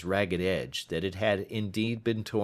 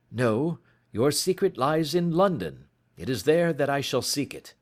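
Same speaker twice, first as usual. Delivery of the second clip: higher, brisk detective voice; detective pressing for evidence; Holmes urgent; 7 semitones higher, 4 dB louder than usual. Acted. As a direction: urge him on, impatient. Holmes's dialogue voice.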